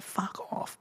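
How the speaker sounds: hushed, breathed fuck off; mock whispered brush-off; whispered aside. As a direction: mutter it under your breath. Private thought vs public reply.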